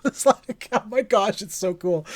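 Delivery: high voice coming out of a laugh; laughing delight. Real, not acted. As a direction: gush while still giggling. Laughing after own Grogu imitation.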